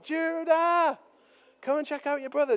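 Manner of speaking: high, loud call to another room. Retold or imitated. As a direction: summon him from afar, urgent. Dad calls son to see injury.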